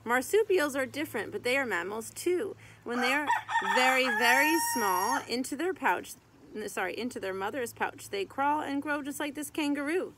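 lively sing-song rhyme reading; reading to children. Acted. Reading a mammal picture book to kids, lively sing-song. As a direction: read the rhyming verse out to little kids.

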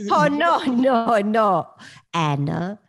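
loud, drawn-out New Orleans character; Maury. Acted. Doing her audition impression for Vinny, in Maury's voice. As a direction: stretch it out in a Cajun character voice. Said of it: "all I did was Maury".

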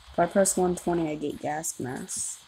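ordinary talk, nothing marked

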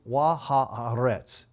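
"wa haaretz" said slowly, each syllable spelled out; Hebrew pronunciation. Shown as a demonstration. Teacher slowly sounds out "wa haaretz" for the class. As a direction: sound out "wa haaretz" carefully for students. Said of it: "The first Hebrew word of verse two is".

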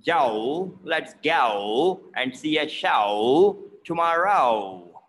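"Go", "show" and "tomorrow" drawn out as ah-oh; "show" as a long, dipping "sha-ow"; exaggerated O diphthong. Shown as a demonstration. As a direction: slowly swoop every O from open to rounded in "go", "show", "tomorrow". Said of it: "like, a O sound".

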